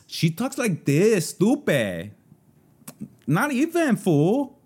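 put-on very high, drawn-out Valley Girl from the San Fernando Valley. Shown as a demonstration. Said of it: "That's how a Valley Girl talks".

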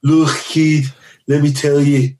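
a gruff Liverpool tour manager; Liverpool accent. Retold or imitated. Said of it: "I had a good Liverpool accent".

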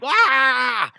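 high shrill yell imitating the driver; mock angry shriek.

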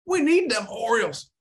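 high-pitched East Coast caricature; mock East Coaster accent. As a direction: mimic a ranting East Coaster in a silly high voice. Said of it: "You sound like such a, uh, such an East Coaster".

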